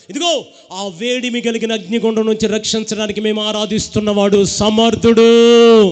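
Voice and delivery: put-on three young men shouting defiance at the king; defiant shout. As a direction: proclaim their faith at full volume. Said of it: "వాళ్ళు అంటారు".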